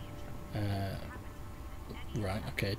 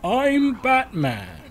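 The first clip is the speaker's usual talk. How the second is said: high, loud, drawn-out superhero impression; Batman; 11 semitones higher, 5 dB louder, much slower than usual. Player showing how the game's hero sounds, high exaggerated impression. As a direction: pitch it way up and stretch it out loudly. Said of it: "It's more like".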